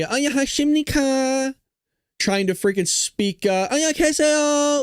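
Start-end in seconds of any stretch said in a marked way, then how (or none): 0.0-1.5 high, fast put-on Korean hello; mock Korean greeting. Reporter trying Korean in South Korea, mock high fast greeting. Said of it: "me trying to be". 3.7-4.8 high, fast put-on Korean hello; mock Korean greeting. Reporter trying Korean in South Korea, mock high fast greeting. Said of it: "like, trying to speak Korean".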